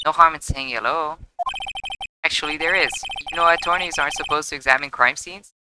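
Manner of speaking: usual speaking voice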